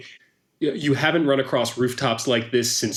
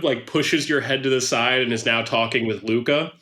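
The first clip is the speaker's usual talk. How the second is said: ordinary delivery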